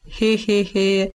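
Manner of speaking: slow, sly wolfish chuckle; a sly villain's drawn-out snicker; slow gloating heh-heh-heh. A narrator's reading. Wolf gloats mid-threat.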